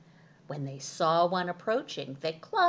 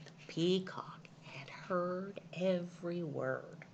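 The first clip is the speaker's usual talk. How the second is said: whisper; 6 dB quieter than usual. A narrator's reading. Narrator whispers suspense.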